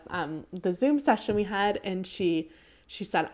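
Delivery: usual speaking voice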